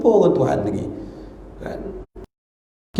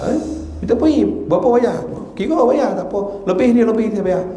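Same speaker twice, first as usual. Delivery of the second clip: eager businessman; 7 semitones higher than usual. Retold or imitated. Eager businessman paying for courses.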